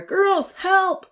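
high, loud cry; calling for help. A narrator's reading. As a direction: yell to the girls uphill.

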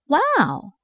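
high, impressed exclamation; exclaimed wow. A narrator's reading. Verity reading the definition of the word, exclaiming in amazement. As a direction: let it rise high and drop in wonder. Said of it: "Verity exclaimed".

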